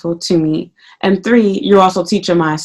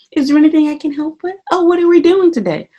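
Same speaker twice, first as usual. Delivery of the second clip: sweet fake helper voice; 8 semitones higher than usual. Mocking coworkers' fake helpfulness.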